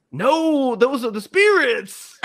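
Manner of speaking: high, loud, hammed-up protest; insistent mock shout. Driver contradicted about marsh vapors, mock insistent shout. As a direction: cry it out as an indignant correction.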